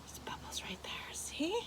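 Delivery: hushed whisper in wonder. Real, not acted. Whispers at bubbles.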